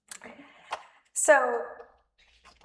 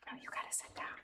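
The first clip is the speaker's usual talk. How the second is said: hushed aside; 14 dB quieter, faster than usual. Real, not acted. Leader to a child mid-service, hushed aside. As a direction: whisper it low, off to the side.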